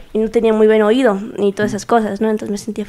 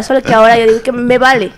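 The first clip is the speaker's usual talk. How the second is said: ordinary talk, nothing marked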